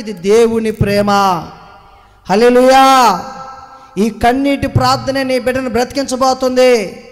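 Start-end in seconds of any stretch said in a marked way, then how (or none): 2.3-3.2 a big, loud hallelujah with a falling end; loud preaching hallelujah; shouted hallelujah. Real, not acted. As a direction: shout it out to lift the church.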